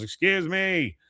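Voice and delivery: lightly voiced Dale barging in; mock Dale. Dale barges in.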